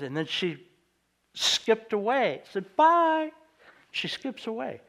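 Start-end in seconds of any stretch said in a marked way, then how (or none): 2.8-3.3 much higher, louder chirpy bye; little girl's goodbye, much higher; child's bye. Retold or imitated. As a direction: sing out bye like a little girl skipping off. Child skipping away.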